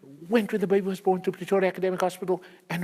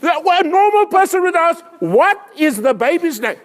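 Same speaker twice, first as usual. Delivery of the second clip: mock rant; 8 semitones higher, 5 dB louder than usual. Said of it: "mock rant at audience".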